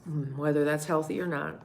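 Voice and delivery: usual speaking voice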